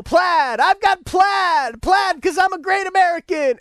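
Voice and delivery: high, loud mock-patriot holler; hammed-up proud boasting. Plaid-flag owner bragging to everyone, mock proud. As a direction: crow it like a ridiculous flag fanatic.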